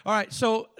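ordinary talk, nothing marked

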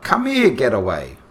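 calling a dog across the park, loud. Retold or imitated. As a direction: yell it out to the dog. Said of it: "he yells out".

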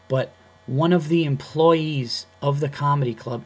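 normal talking voice

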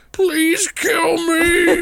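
very high, slow, shouted plea; pleading wail. Retold or imitated. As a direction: wail it out, dragging each word. Biocop voiced begging.

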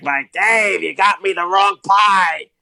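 high, slow, exaggerated Boston drawl; hamming up a thick Boston commentator; over-the-top Boston accent. Podcast host mimics the Boston color commentator, hammed-up Boston accent. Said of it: "he talks like this".

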